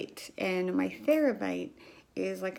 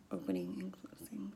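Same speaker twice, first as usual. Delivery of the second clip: whisper; 13 semitones lower, 11 dB quieter than usual. Real, not acted. Whispered while doing the exercise.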